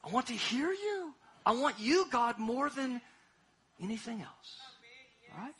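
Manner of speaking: usual speaking voice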